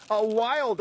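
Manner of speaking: plain reading voice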